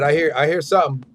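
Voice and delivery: ordinary delivery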